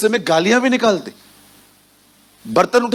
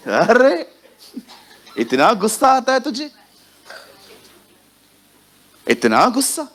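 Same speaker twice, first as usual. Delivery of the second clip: higher, teasing scold; mock exclamation; 6 semitones higher than usual. To the congregation, teasing the hot-tempered with a mock exclamation. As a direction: tease them for their temper in a raised voice.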